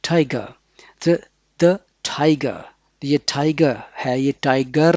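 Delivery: "tiger" said as "taiga", no R at the end; non-rhotic tiger. Shown as a demonstration. Teacher models British "tiger" without the R for the class. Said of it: "र की आवाज़ इसमें नहीं आएगी".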